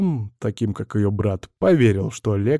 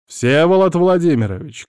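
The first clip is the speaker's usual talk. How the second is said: raised, louder call into the room; shout; 5 semitones higher, 4 dB louder than usual. A narrator's reading. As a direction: call out a name. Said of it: "крикнул я". (кричит)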